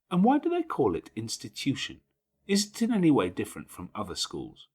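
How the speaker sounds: hint of an inquisitive young girl; curious child. A narrator's reading. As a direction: push the question, puzzled and eager. Jane presses her questions.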